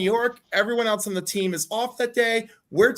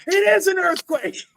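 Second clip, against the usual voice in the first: much higher, louder certain call; startled manager; 9 semitones higher, 5 dB louder than usual. Retold or imitated. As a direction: blurt it out from off camera. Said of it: "my manager off camera going".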